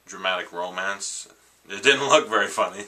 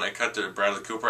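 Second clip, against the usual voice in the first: ordinary talk, nothing marked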